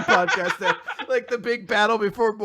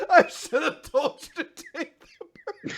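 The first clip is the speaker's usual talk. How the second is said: laughing retelling; 7 semitones higher than usual. Retold or imitated. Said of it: "he says that at the end".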